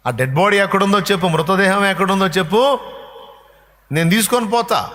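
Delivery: bold demand. Acted. Mary demanding the body.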